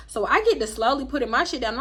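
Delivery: ordinary delivery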